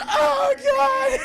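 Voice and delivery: high, loud panicked yelp; startled shriek. Retold or imitated. Cruise jolted awake by the sergeant, loud shriek. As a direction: cry out in hammed-up shock.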